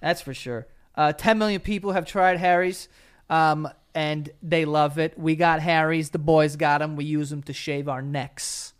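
upbeat sponsor read. Acted. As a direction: sell it like a host ad. Reads sponsor ad.

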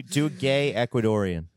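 ordinary talk

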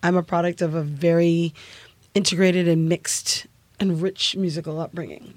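ordinary talk, nothing marked

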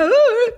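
high loud dramatic cry; mock wail. Mom crying at a movie, hammed-up high wail. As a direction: ham up a sobbing wail. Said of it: "Ya hago mi drama".

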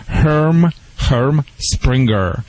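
Herm Sprenger said very slowly, each syllable drawn out; slow careful name. Shown as a demonstration. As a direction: spell the name out slowly for listeners. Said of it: "Uh, Hal, translate, please".

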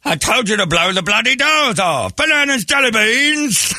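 high, loud Cockney Michael Caine impression; Michael Caine accent. Host mimicking Michael Caine to the crew, hammed-up Cockney accent. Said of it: "I gotta add the accent in it".

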